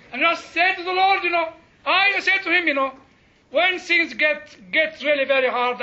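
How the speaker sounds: usual speaking voice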